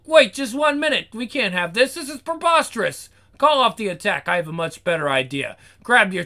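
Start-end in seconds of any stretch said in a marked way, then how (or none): 0.0-4.3 alarmed protest. Acted. Devil halting the attack.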